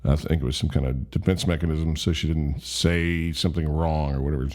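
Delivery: usual speaking voice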